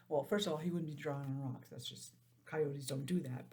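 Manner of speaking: ordinary delivery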